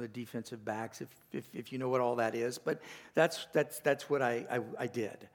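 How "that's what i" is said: normal talking voice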